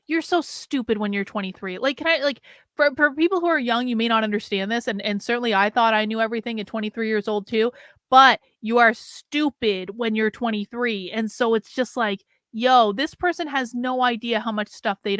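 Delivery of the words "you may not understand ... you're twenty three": normal talking voice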